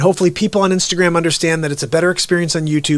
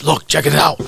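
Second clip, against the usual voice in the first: raspy, strained growling rant voice; Alex Jones; 2 semitones lower than usual. Acted. Host showing viewers how he talks, raspy impression. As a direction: push it out rough from the throat. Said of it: "Alex Jones kinda talks like this".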